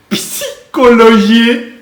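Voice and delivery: slow, stretched, rising punchline; drawn-out punchline. Acted. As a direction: stretch the answer out slowly.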